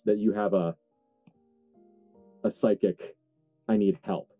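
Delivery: ordinary talk, nothing marked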